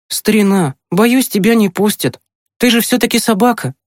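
put-on high boy's voice breaking news to a dog; regretful boy. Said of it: "Тимкин вздохнул". (с сожалением, высоким мальчишеским голосом)

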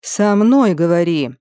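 ordinary delivery

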